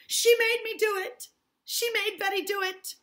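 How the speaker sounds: high, loud pointing cry; accusing shout. Acted. Abigail accusing Tituba before the adults, loud. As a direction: fling the blame at her.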